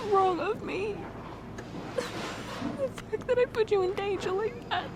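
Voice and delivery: high, soft, broken repeated sorrys; sobbing apology. Acted. Begging her partner's forgiveness, softly sobbing. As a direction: beg forgiveness through quiet sobs.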